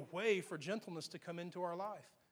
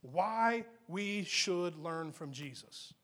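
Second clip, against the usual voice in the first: slowly, word by word; drawn-out teaching line; slow emphatic repeat; much slower than usual. Shown as a demonstration. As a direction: spell it out slowly for note-takers. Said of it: "write this verse down for your notes".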